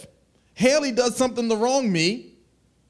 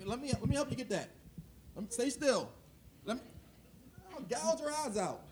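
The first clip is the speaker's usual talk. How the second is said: hushed, overeager helper voice; a hushed, mock-sweet meddler; mock helpful; 3 semitones higher, 13 dB quieter than usual. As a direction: softly offer help with creepy zeal. Mock helper in speck parable.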